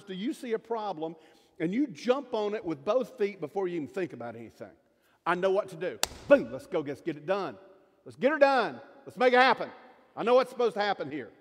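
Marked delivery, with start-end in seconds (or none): 5.2-11.3 loud, punchy mock go-getter; hammed-up type A eagerness; gung-ho impatience. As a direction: charge in, bursting to take action. Acting out impulsive type A person.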